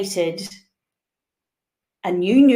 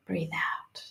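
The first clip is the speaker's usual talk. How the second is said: usual speaking voice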